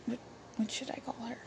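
quick whisper to herself; fast private whisper, mostly to herself; whispered aside. Real, not acted. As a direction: rush it under her breath while thinking. Muttered aside while choosing name.

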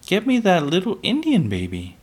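put-on high-pitched little girl asking; child's demand. A narrator's reading. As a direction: ask Pa insistently in a high child's voice. Child demanding of her father.

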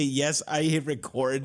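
normal talking voice

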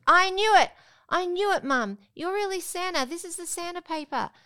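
high, loud triumphant I knew it; excited accusing child. Retold or imitated. Little girl catching mum out, high and loud. Daughter discovering Santa paper.

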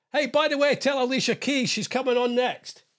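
mock call to someone backstage. Calls out to pretend backstage crew.

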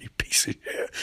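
quiet mock whisper of contempt; whispered insult. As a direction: whisper the insult after a laugh. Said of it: "Barry's like".

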